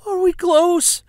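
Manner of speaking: high, loud screech of pain. Acted. Wife in labor shrieking at her husband, loud screech. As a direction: shriek the question in labor pains.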